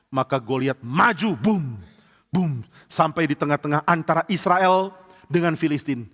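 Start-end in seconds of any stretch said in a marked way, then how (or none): none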